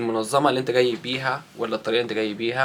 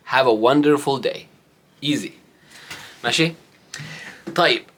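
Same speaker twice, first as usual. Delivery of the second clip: sarcastic farewell; 3 semitones higher, much slower than usual. Acted. Sarcastic send-off to critics.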